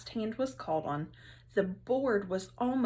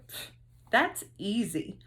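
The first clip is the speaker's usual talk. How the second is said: dismissive pff. Acted. Teacher scoffing at easy task.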